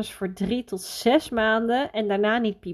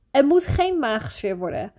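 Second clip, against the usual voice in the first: ordinary talk, nothing marked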